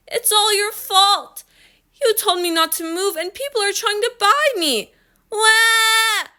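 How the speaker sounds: put-on high, whiny child's protest; wailing complaint. A narrator's reading. Daughter blaming her father from the shelf, wailing. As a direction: whine high, building to a bawl.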